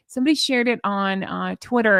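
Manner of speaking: ordinary delivery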